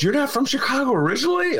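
high, quick mock astonishment; imitated surprise. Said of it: "And she goes".

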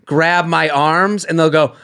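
ordinary delivery

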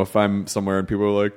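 ordinary talk, nothing marked

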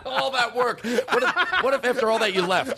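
high, helpless laughter; laughing hard. Real, not acted. Host laughing at his guest's trap. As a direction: crack up at the twist.

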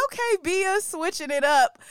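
high, amused "okay, she's switching it up"; surprised. Retold or imitated. Hearing [name]'s UK drill verse for the first time, high surprised voice.

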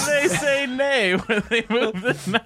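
slow, laughing half-shout. Real, not acted. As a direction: choke the words out laughing. Laughing fit while joking.

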